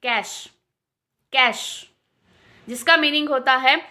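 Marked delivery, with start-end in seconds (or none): 0.0-1.9 कैश said as "cash"; कैश, teaching the right sound; correct pronunciation. Shown as a demonstration. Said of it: "उससे हम pronounce करेंगे कैश".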